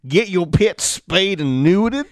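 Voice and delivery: put-on loud, slow British accent; mock-British sign-off, drawn out. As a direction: declaim it in a hammed-up British voice. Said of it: "That's my British accent".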